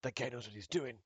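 a cool rival sizing up his opponent; lightly voiced anime fighter; anime rival moment. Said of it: "It's like the anime moment".

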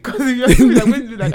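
loud laugh through the words. Real, not acted.

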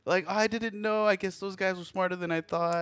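imitating a flustered mom; apologetic mom. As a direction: voice her flustered sorry.

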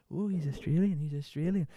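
high, teasing "ooh" murmur; mock the whispering kids; lightly voiced gossiping classmates. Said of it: "whispering under their thing".

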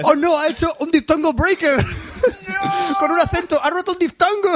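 high laughing mock alarm; hammed-up panic, laughing through it; mock horror. Laughing co-host fakes horror at a broken diphthong.

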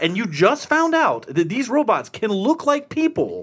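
exasperated rant. Retold or imitated. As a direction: build a frustrated lecture to the screen. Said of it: "I'm like".

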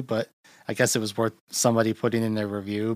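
ordinary talk, nothing marked

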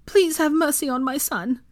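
high, tearful-edged appeal; pleading. A narrator's reading. Mother begging mercy for her son, pleading. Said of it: "Molly pleaded".

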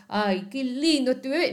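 hammed-up baby-lover gush; mock baby cooing. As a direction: coo it like a doting aunt. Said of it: "No te digo que seas".

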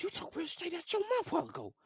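squealing falsetto disbelief; hammed-up high shriek of questioning; incredulous confrontation. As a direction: act out a very high-pitched, shocked challenge. Acts out confronting the strange-voiced man.

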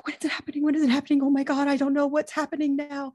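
a light mock of anxious waiting; anxious impersonation. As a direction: imitate a friend anxiously waiting for a post. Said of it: "You just did a great impersonation of me".

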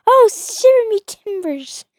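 high, slow mock pirate; drawn-out pirate exclamation. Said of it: "I'm like".

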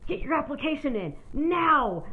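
loud, high imitated command; acted-out urgent demand; manager's order. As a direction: bark it like a manager, punching the last word. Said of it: "Then the manager speaks up".

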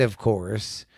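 usual speaking voice

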